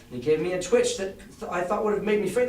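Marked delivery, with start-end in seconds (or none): none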